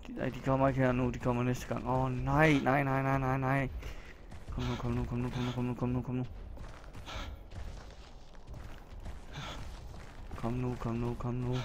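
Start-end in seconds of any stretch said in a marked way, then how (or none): 4.5-11.6 muttering. Real, not acted. Urging the game under breath.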